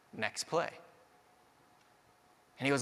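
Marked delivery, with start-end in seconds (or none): 0.1-0.7 softer, quiet encouragement; a coach quietly nudging a frustrated player; gentle nudge. Retold or imitated. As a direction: say it low like a nudge on the shoulder.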